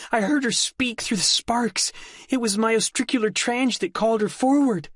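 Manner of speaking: put-on high, urgent girl voice; young woman voice. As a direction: insist like a frightened girl. Delphi insisting, high voice.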